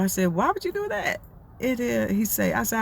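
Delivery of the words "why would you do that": incredulous. Retold or imitated. She reacts to her friend.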